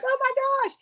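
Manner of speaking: very high, giddy gasp; retold moment of stunned joy, very high; thrilled gasp. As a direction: gush with disbelief. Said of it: "I'm like".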